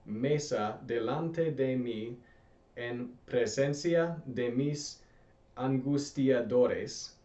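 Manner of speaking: "aderezas" restarted, then read very slowly and clearly; teacher correcting herself and reading slowly for students; slow corrected reading. Shown as a demonstration. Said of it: "or sorry".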